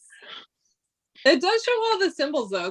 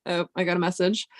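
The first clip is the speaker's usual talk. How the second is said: ordinary talk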